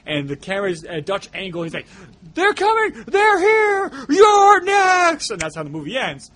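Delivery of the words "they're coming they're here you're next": frantic screamed warning, much higher and louder; screaming warning. Retold or imitated. Host reenacting Kevin McCarthy screaming at passing drivers. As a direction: scream it at the camera like a doomed man. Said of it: "he ends up screaming at the camera".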